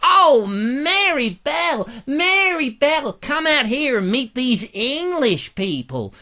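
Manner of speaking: a slow, high Southern American voice gushing over English visitors; excited Southern shopkeeper. Retold or imitated. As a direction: call out to his wife in a thrilled American twang. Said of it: "he said".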